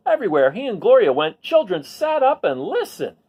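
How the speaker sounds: lively storybook read-aloud; reading to children. A narrator's reading. Teacher reads a picture book to the class, lively storybook voice. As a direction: tell the story to a class of kids.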